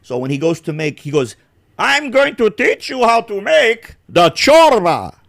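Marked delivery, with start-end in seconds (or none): 1.8-5.2 high, loud, slow mock voice; big in the mouth, hammed up; imitating the American vlogger. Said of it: "That's how he talks".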